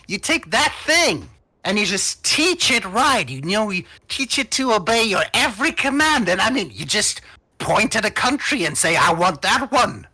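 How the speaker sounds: high, eager pitch rising over the talk; excitable scheming gnome. Acted. Gnome pitches the dragon's power to the party, eager and excitable. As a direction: sell the grand plan excitedly.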